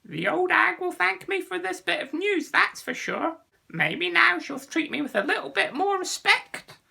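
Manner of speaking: high, loud, scheming bird voice; chuckling green bird. Acted. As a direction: gloat to yourself as a bird. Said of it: "the green bird chuckled to itself".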